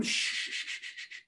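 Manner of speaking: long unvoiced shh sounds; repeated shushing. Imagined Jesus hushing the shouting children, shushing. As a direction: hush a crowd of kids with shh.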